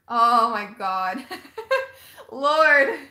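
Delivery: loud slow oh my god into laughter; laughing outburst. Real, not acted. Reacting to chat about Shane's daughter, laughing loudly. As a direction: laugh it out, overwhelmed. Overcome by viewer comment.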